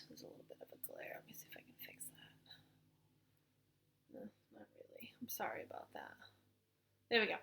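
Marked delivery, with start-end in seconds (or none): 0.1-6.1 a quick whispered aside off to one side. Real, not acted. Teacher fixing a screen glare, whispering an aside. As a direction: mutter it under your breath.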